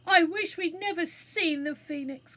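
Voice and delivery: high, loud wail of regret; distressed cry. A narrator's reading. Jane trapped by fire, regretting the Phoenix, distressed cry. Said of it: "cried Jane".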